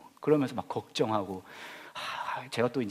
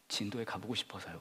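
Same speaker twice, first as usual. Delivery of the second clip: soft grieving quote; 8 dB quieter than usual. Retold or imitated. Quoting grieving mother softly.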